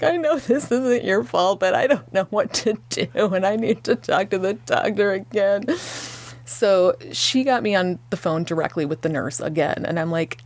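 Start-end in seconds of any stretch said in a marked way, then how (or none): 0.0-5.6 tearful plea. Retold or imitated. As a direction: beg for help through tears. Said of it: "And I'm just like".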